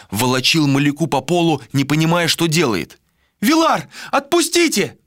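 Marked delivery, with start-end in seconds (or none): 3.4-5.0 very high, desperate plea; crying out. A narrator's reading. Malika dragged across the floor, crying out. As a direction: cry out high for release. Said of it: "закричала она".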